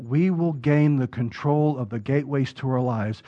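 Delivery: normal talking voice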